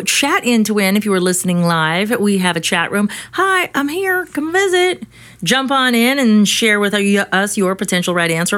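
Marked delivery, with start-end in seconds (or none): 3.3-5.0 high, chirpy little voice; high cutesy voice. Pretend. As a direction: play a cheerful chat user. Voicing a chat room greeting.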